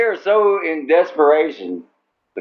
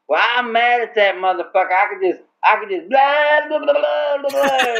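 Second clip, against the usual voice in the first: much higher, mocking whiny rant trailing into blah blah; 9 semitones higher than usual. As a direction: parody someone sulking and ranting, pitched way up. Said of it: "that makes them go like".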